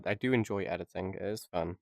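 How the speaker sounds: normal talking voice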